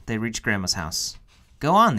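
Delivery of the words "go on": high, louder gentle push; encouraging. A narrator's reading. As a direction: urge her on kindly. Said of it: "the animals encouraged Kiko".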